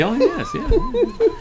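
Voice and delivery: very high, slow, drawn-out laughing yes; giggling drawn-out agreement. Real, not acted. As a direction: let the laugh stretch the yes way up.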